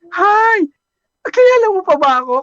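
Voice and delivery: put-on high, loud and fast girl's voice; girlish greeting. Returning customer greeting her barber, high and girlish. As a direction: burst in with a chirpy hello.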